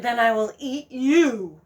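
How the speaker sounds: slow, drawn-out threat; menacing fox. Acted. Fox threatening to eat the goose, slow menace. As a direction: savour the threat slowly.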